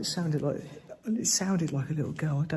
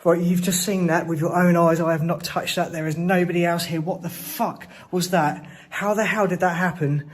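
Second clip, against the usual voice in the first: higher, louder, still rattled; shaken; 4 semitones higher, 4 dB louder than usual. Real, not acted. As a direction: insist on it, heart pounding. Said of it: "this is just so terrifying".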